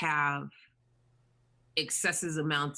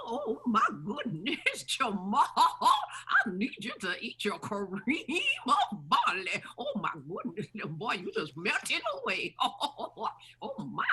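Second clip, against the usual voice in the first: much higher, a cackling fussy grandma; fussing granny; 8 semitones higher than usual. Retold or imitated. Grandmother fussing over Jamal eating his barley, high and laughing. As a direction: play a doting granny who laughs as she scolds. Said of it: "my favorite had to be Granny".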